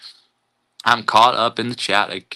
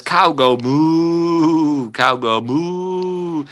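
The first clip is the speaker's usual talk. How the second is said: slow, stretched "cow go moo" like a lowing cow; drawn-out cow voice; 3 semitones higher, much slower than usual. Pretend. Reading a viewer's message aloud to chat, slow mooing cow voice. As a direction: draw each word out slowly, mooing.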